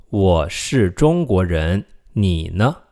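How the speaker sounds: slow, careful teaching read; slow model sentence. Shown as a demonstration. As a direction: say it slowly for learners to repeat. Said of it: "Do you remember how to say this?".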